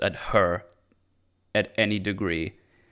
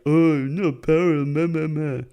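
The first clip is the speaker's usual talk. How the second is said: mocking whine; 2 semitones higher than usual. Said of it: "It's like".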